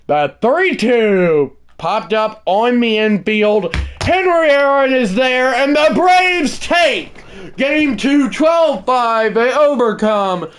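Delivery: rising to a shout on Henry Aaron; play-by-play call. Acted. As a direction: call it like a TV broadcaster. Calls the game's final out.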